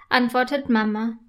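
usual speaking voice

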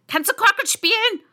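high, loud shrieked question; shouting queen. A narrator's reading. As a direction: yell the question like an imperious queen. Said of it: "schrie die Königin".

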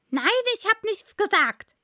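high, loud interrupting denial; hastiger Faselhase. A narrator's reading. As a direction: cut in as a high-voiced March Hare. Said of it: "unterbrach ihn der Faselhase schnell". (hoch und laut dazwischenrufend)

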